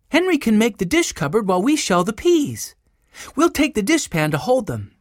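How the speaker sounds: put-on high, animated girl's voice; organizing girl Jessie, keen. A narrator's reading. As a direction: lay out plans like a keen elder sister. Jessie directing the children.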